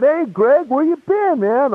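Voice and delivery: high-pitched imitation of puzzled buddies; surprised greeting. Old buddies greet a long-missing friend, voices high. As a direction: lift the voice like friends calling out.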